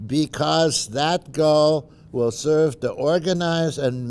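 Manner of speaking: rally the crowd like a president at a podium; formal speech. Shown as a demonstration. Reading Kennedy Rice speech.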